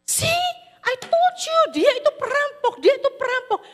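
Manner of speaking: high, loud told-you-so shriek; the wife yelling I told you so; shrill accusing wife. Acted.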